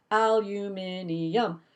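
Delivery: "aluminium" slow, five syllables al-u-MIN-i-um; fifth syllable. Shown as a demonstration. Mocking the Australian five-syllable "aluminium", slowly. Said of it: "you put a fifth one in there".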